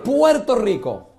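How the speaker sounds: Puerto Rico with a rolled Spanish r, slow and loud. Shown as a demonstration. Magician teaching the audience his island's name, Spanish pronunciation. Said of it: "Can you say Puerto Rico?".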